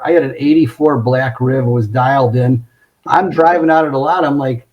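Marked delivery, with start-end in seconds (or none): none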